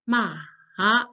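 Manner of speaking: Mandarin third tone dip. Shown as a demonstration. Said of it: "it sounds like this".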